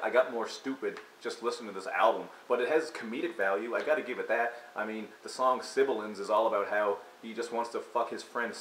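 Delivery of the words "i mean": normal talking voice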